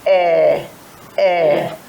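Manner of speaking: a hint of a deaf old person asking "Eh, eh"; mock hard of hearing. Shown as a demonstration. Teacher voicing a girl pretending not to hear. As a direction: play an older person who can't hear. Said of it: "Can you say it".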